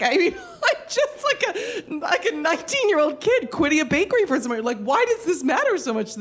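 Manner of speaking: laughing. Real, not acted. Laughing at the drama.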